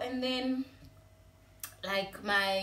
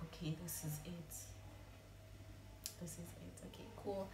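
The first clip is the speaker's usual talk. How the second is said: hushed breathy whisper, much softer; whispering to herself; 13 dB quieter than usual. Retold or imitated. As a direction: whisper it to yourself to hold back tears. Retold inner thought in car.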